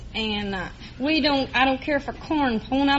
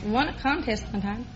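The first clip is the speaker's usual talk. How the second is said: normal talking voice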